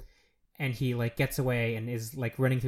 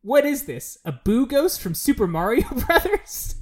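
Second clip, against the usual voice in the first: high, loud quoted disbelief; mock incredulous; 10 semitones higher, 8 dB louder than usual. Quoting Tacoma's joke to the cohost, loudly mock incredulous. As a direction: blurt it out, imitating a baffled character.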